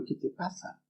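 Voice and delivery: breathy whispered question, no voice at all; hushed whisper. Retold or imitated. Pastor whispering to his crying friend on the phone, hushed.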